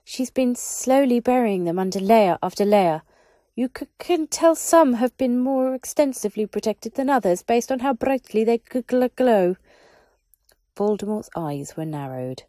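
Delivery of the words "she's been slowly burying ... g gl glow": higher, louder, stammering little wizard; an anxious underling reporting to his master; high nervous wizard voice; nervous small wizard. Acted.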